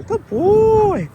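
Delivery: high, loud praise for the dog; squeaky praising a dog. Real, not acted. Praising dog after a catch.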